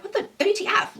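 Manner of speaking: high mock disbelief; baffled. Owner stunned by the trainer's question, high baffled squeak. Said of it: "that they're like".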